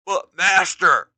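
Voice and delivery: hesitant Beast Man. Acted. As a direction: start to object. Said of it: "Beast Man begins".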